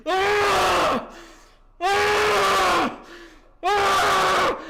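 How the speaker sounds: loud, high cries of pain, one after another; retold screams of pain. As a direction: scream out like a man in agony. Brother screaming in hospital.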